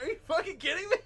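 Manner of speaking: high, loud incredulous outburst with laughter; hammed-up, laughing disbelief; amused disbelief. Teacher hearing why student paused, amused disbelief.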